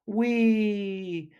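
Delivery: high, loud delighted whee; tortoise sailing through the air; gleeful whee. A narrator's reading. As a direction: squeal with joy as she flies. Sliding down hill, flying.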